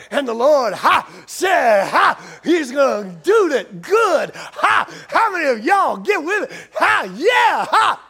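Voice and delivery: hammed-up revival preacher, gasped "ha" between bursts; T D Jakes. Pastor mimics what his Bible college buddies copied, hammed-up preacher shout. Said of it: "they liked T D Jakes".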